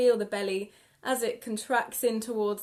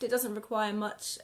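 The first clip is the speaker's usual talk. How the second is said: normal talking voice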